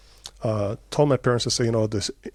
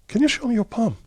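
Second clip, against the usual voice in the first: much higher, pressing the question the second time; repeated insistent ask; 11 semitones higher than usual. Retold or imitated. Repeating the question to the puzzled teacher, pitched high and insistent.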